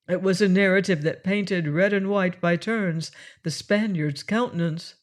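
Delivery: usual speaking voice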